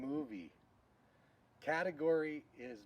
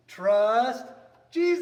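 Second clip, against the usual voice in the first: loud, high rallying cry; shouted slogan; 9 semitones higher, 17 dB louder, much slower than usual. Real, not acted. Teacher rallying the class with a big shout. Kids lesson catchphrase call-out.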